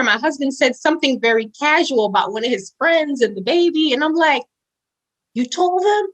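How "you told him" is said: high-pitched mock-indignant question; retold disbelief. Wife catches husband telling a friend, high disbelief. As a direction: challenge him, voice shooting up.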